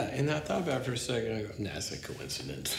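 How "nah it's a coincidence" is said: low, soft brush-off; dismissive inner voice. Retold or imitated. Storyteller replays waving off the criticism to himself, low offhand dismissal. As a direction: drop it down like a private shrug.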